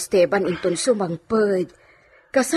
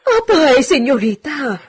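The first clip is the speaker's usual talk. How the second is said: alarmed; 5 semitones higher, 5 dB louder than usual. Acted. Sees mistress on floor.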